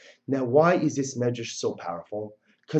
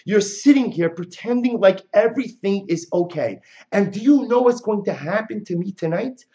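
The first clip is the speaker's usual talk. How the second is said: impassioned accusing bride, voice raised and pitched up; outraged bride; 6 semitones higher, 5 dB louder than usual. Acted. Bride berating the wedding guests, raised outraged voice. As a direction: hurl it at the guests like a wronged bride. Voicing Chana's outraged speech.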